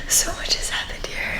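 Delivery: breathy near-silent whisper; whispered to herself. Real, not acted. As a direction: barely breathe the words out. Overcome while leaving home.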